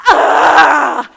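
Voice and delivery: sudden loud cry of shock as the water breaks; retold shriek of surprise, loud; startled scream. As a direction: yelp out in alarm. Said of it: "and I went".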